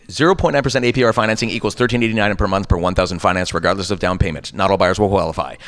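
rapid-fire legal disclaimer; all in one breath. Shown as a demonstration. Voice actor demonstrates radio legal copy, all in one breath. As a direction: race through the fine print without stopping. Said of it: "all in one breath".

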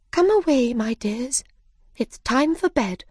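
put-on high, fussy call to her young; mother bird calling. A mother bird calls her children to bed, high and fussy. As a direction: call the little ones away. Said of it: "called to her children".

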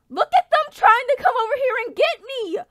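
loud high yell at the enemies; panicked gamer yell; alarmed shout. Real, not acted. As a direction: yell as they rush you. Alarmed as enemies rush him.

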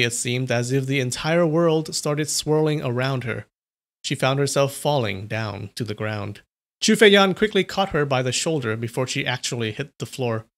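usual speaking voice

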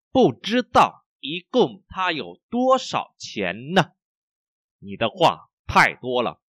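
slow, syllable-by-syllable model reading; slow, carefully enunciated Mandarin for learners. Shown as a demonstration. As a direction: read slowly so each tone is heard. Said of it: "mình đọc rất là chậm".

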